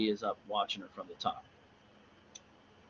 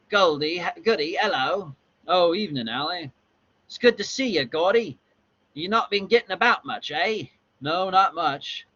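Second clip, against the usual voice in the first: Cockney; 8 semitones higher, 9 dB louder than usual. Acted. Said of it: "this is Cockney".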